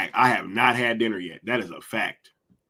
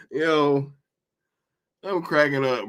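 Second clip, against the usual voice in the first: ordinary talk, nothing marked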